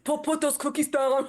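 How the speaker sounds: Arnold Schwarzenegger. Acted. Co-host joins in about the cookies, in mock Austrian accent. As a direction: order it like an Austrian action hero. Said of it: "Do it in Arnold Schwarzenegger voice".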